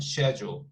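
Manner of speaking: "Schedule" with a sh start, shedjool; British schedule. Shown as a demonstration. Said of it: "Actually, it's really hard".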